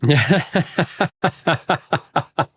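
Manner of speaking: high, hearty laugh; real laughter. Real, not acted. Laughing at the joke.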